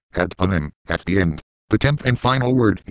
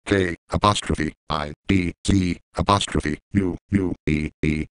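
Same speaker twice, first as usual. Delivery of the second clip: spelled-out letters; 4 semitones lower than usual. Shown as a demonstration. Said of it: "To fix this the lexicon way".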